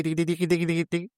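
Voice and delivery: soft vocal harmonica riff; imitating harmonica. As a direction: mimic a little harmonica line under your breath. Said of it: "Con una armónica ahí".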